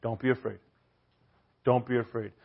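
soft, hushed comfort; gently calm a terrified man; tender, quiet "do not be afraid"; reassuring. Retold or imitated. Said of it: "what does he say".

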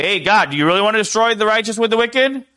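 mock disrespectful outburst; brash, disrespectful demand. Shown as a demonstration. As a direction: fire it at God like a complaint. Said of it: "You're not gonna say".

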